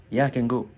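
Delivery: "can" passed over lightly into "go"; short "ken" for "can", no pause; quick, light CAN. Shown as a demonstration. Teacher showing the positive form, quick light "can". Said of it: "On ne perd pas le temps sur le premier can".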